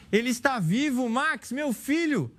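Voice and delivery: relieved cry. A narrator's reading. Mother's relief, child saved.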